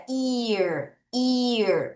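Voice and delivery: "ear" with a long ee gliding into r; pronounced EAR. Shown as a demonstration. Teacher shows pupils how EA sounds, drawn-out "ear". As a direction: say "ear" with an ee sound, not a short e. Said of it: "A가 애가 아니라".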